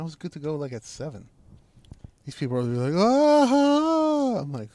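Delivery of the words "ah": long high "aah"; imitated holler. Drinker mimicking the people around him, long high holler. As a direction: hold a high wailing yell.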